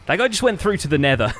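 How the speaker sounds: usual speaking voice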